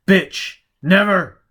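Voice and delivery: loud snarled insult and refusal; angry man shouting at her; furious outburst. Acted. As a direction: spit it at her in rage.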